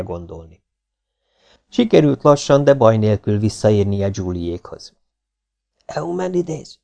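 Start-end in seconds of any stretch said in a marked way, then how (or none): 5.9-6.8 high, soft, half-whispered call; hushed whispering girl. A narrator's reading. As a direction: call out under your breath from hiding. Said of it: "suttogta átszázott búvó helye mélyéről a lány".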